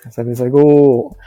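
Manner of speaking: loud, drawn-out mock slogan; mock boast. Teasing the partner who dislikes Kansai-dialect boasters, mock-boastful. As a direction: stretch it out loudly to tease.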